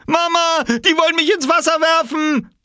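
very high, loud cry for mother; screaming. A narrator's reading. Small boy calling mother for rescue, panicked scream. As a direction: wail it at top pitch. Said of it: "schrie Oskar".

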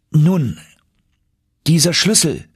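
put-on eager young man pushing the point; young nephew voice.

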